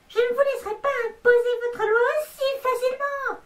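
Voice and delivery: defiant, high, strained voice; high heroic cry. Acted. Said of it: "je vais faire toutes les voix".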